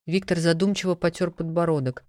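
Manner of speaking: ordinary delivery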